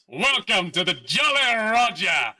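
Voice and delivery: slow, high theatrical pirate welcome; pirate captain voice. Acted. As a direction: boom the ship's name grandly. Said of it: "turns around and goes".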